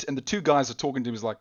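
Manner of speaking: ordinary talk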